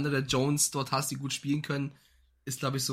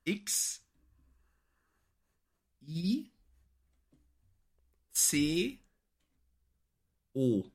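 over enunciating; slower than usual. Shown as a demonstration. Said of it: "du musst mitschreiben".

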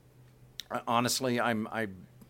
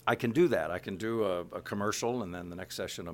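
normal talking voice

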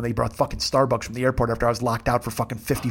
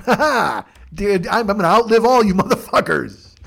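loud, high mock-villain triumph; hammed-up vow of revenge; gloating defiance; 4 semitones higher, 3 dB louder than usual. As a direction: cackle and crow at your enemies. Gloats about hiding there forever.

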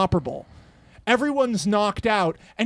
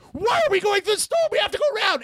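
alarmed spy; 12 semitones higher, faster than usual. Retold or imitated. Real spy confronts the captain.

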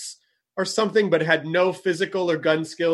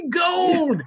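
high-pitched prospector cackle and cry; cackle, then crow it like a crazed gold-digger; old prospector. Acted. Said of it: "It's that guy".

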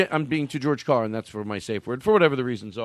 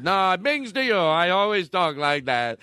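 higher, insistent fake Australian accent; mock Australian, pitched up and drawn out; 5 semitones higher, slower than usual. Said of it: "I have a fake accent".

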